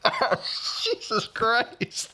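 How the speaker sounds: high, slow, laughing "Jesus Christ"; high, stretched "Jesus Christ" with a laugh; laughing disbelief. Retold or imitated. Retold reaction to the director.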